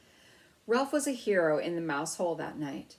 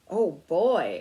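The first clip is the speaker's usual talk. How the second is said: much louder burst of joy; excited exclaim; 10 dB louder than usual. Acted. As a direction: blurt it out delighted. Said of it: "exclaimed Ralph".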